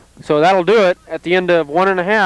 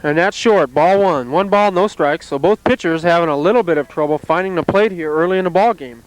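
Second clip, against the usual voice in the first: normal talking voice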